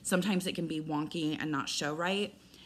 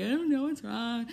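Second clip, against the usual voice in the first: crying; 5 semitones higher, 3 dB louder than usual. Retold or imitated. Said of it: "So then I started crying".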